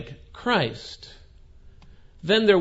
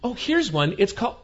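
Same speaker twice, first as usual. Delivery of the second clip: high, chirpy find; lightly voiced clerk, higher, pleased with herself; a young clerk announcing a book; bright clerk; 8 semitones higher than usual.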